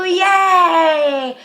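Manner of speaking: a mock kids' yay with a laugh; kids cheering.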